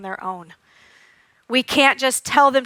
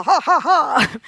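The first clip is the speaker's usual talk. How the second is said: calm laugh; 3 semitones higher, slower than usual. Shown as a demonstration. Showing parents how a calm child laughs, relaxed ha ha ha. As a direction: laugh from a full breath, relaxed. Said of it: "When you're calm".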